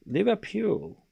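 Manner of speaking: high, soft mock Scouse; Scouse accent. Shown as a demonstration. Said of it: "how do you say Liverpool in Scouse".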